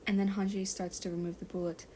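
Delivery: usual speaking voice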